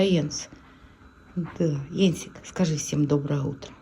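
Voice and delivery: ordinary talk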